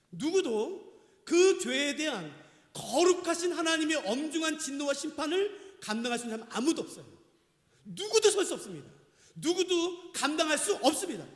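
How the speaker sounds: emphatic sermon warning; forceful preaching. Real, not acted. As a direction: declare it with grave pulpit intensity. Preaches nobody can bear judgment.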